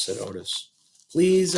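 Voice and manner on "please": high pleading please; begging. A narrator's reading. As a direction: beg him to come. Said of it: "I begged".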